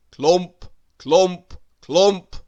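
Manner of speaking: each clump stomped loud; heavy footstep chant; loud clumping steps. Acted. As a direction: boom out every clump. Mister Noisy's loud footsteps.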